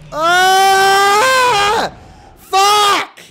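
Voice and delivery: raw high yell of rage; real angry gamer scream; furious scream. Real, not acted. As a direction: scream out in frustration. Troll hits him, screams.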